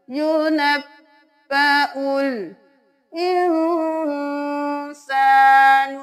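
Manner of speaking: slow, melodic tajweed chant; Quran recitation. Shown as a demonstration. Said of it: "حمزہ زیر نون ان پڑھیں گے". (تلاوت کے انداز میں)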